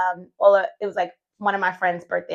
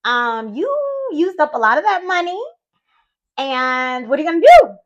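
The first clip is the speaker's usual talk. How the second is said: higher, louder, challenging herself; sassy self pep talk; 5 semitones higher, 7 dB louder than usual. Retold or imitated. Broke after the trip, sassily challenging herself. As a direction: give yourself a sassy reality check. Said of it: "I was like".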